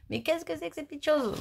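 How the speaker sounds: high, playful put-on voice; mock curious question. Plays a curious viewer's question. (fausse curiosité, voix aiguë et jouée)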